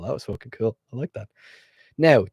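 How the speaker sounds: usual speaking voice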